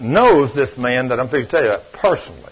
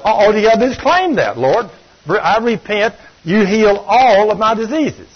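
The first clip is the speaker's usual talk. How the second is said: louder, higher fervent sermon delivery; preaching fervour; 6 semitones higher, 4 dB louder than usual. Acted. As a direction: proclaim the promise with passion.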